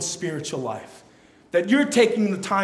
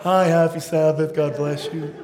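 put-on polite church-mom voice; sweet church greeting; 2 semitones lower than usual. Mom greeting folks at church, put-on pleasantness. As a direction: greet them with showy niceness.

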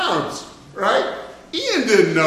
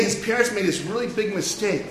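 usual speaking voice

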